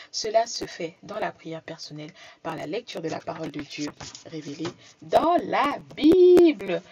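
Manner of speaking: loud preaching burst. Real, not acted. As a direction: cry it out like a preacher. Preaching surge.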